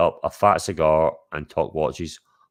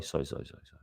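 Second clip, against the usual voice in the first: breathy whispered string of apologies; whispered sorry; 6 semitones lower, 18 dB quieter, much faster than usual. Real, not acted. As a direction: whisper it under your breath, embarrassed. Embarrassed by wrong picture.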